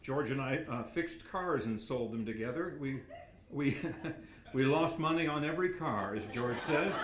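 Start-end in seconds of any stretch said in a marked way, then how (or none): 3.8-4.5 breathy laugh. Real, not acted. Laughing at own joke.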